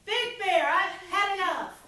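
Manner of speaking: the wind yelling at Bear, fed up; angry yell. A narrator's reading. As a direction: yell at Bear, you've had enough. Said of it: "And she yelled".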